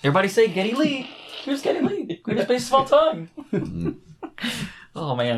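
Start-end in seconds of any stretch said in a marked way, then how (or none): 0.0-3.3 high, fast hammed-up announcer; mock arena introduction. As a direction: hype it like a ring announcer. Mock announcing [name].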